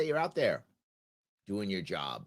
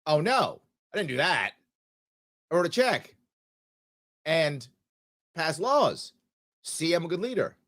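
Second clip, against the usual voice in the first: mock smug politician; 3 semitones higher, 4 dB louder than usual. Mock politician boasting, louder.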